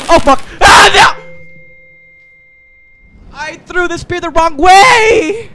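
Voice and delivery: high, loud cry of dismay; dismayed shout. Real, not acted. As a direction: yell as it all goes wrong. Sudden failure in game.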